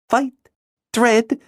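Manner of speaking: put-on high girl's voice, questioning; a puzzled girl reads his written words. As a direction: read each word, baffled.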